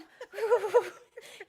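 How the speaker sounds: a loud, giddy burst of laughter; excited high laugh. Real, not acted. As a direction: laugh out the emotion. Overwhelmed after the song.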